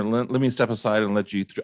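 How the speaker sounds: ordinary talk, nothing marked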